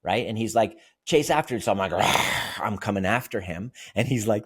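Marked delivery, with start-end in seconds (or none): 1.0-1.6 put-on higher, playful child's voice; young son at play. Voicing his young son at play. 1.9-2.2 loud playful monster growl; monster roar. Retold or imitated. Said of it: "I'll be the monster".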